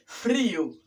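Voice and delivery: "Frío" with a tapped R after F; "Frío" as FRI-o; correct R. Shown as a demonstration. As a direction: say "Frío" with R, not L. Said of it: "sino con R".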